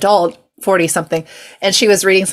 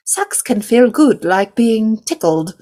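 slow, drawn-out higher reading voice; mock book narrator; 3 semitones higher than usual. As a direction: recite it slowly like a creepy children's book.

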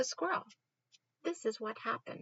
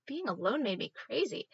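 ordinary delivery

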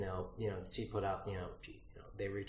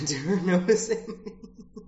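laughing; 9 semitones higher, 10 dB louder, slower than usual. Real, not acted. Laughing about being noticed.

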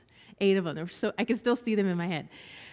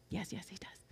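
much softer, hushed and gentle; tender reassurance; 15 dB quieter than usual. Retold or imitated. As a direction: reassure her very quietly. Counselor answering the girls tenderly.

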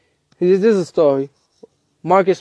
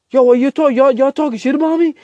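loud, much higher challenge; acted angry confrontation; 11 semitones higher, 5 dB louder than usual. Smart confronting teammates talking about him, acted angry challenge. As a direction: call them out, voice pitched way up.

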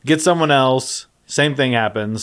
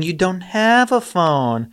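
high, slow mocking voice; high drawn-out mock skeptic; mocking doubters; 6 semitones higher, slower than usual. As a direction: sneer it like a doubter. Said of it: "They're saying".

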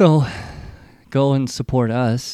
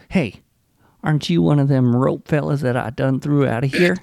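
put-on Louisiana accent; 4 semitones higher than usual. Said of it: "a Louisiana accent".

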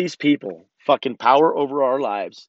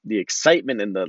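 usual speaking voice

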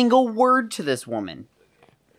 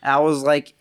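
normal talking voice

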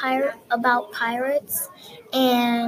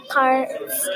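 usual speaking voice

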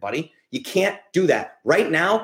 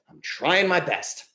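usual speaking voice

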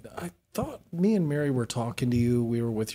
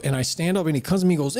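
normal talking voice

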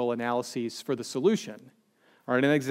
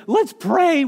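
high, loud acted disbelief; re-enacted yelp of disbelief; incredulous outburst; 12 semitones higher, 8 dB louder than usual. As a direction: throw it back as an outraged question. Acts out his own incredulous retort.

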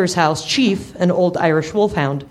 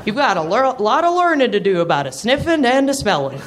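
put-on old dog voice; 6 semitones higher than usual. Chief voiced, pitched up.

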